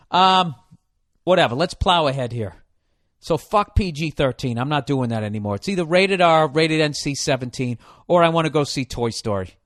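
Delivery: usual speaking voice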